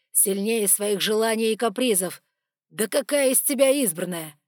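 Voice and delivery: scorn her as chosen one; scornful rage. Acted. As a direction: dragon mocking the chosen one, loud. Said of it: "dragon's scornful rant".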